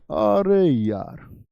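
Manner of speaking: drawn-out imitated groan of regret; mock regret. Teacher imitating students who ignored the advice, drawn-out mock regret. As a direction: drag it out like a student kicking themselves. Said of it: "वो सिर पकड़ते होंगे".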